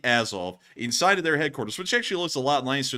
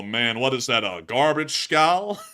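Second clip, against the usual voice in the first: normal talking voice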